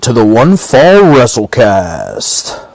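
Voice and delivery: loud, drawn-out announcer call; slow, full-volume wrestling intro; big show opener. Acted. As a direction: belt out the show title like a ring announcer. Show's announcer-style opening call.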